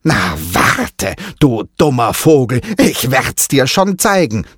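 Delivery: aunt warning the parrot, mildly menacing; threatening aunt. Acted. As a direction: threaten the bird like a cartoon villain. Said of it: "drohte sie gefährlich".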